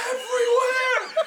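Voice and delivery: high, loud shrieking yell through laughter; retold shout. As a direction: scream it out, cracking up. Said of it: "he just shouts".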